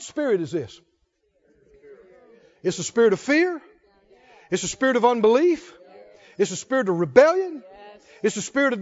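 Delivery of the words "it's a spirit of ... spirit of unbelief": ordinary delivery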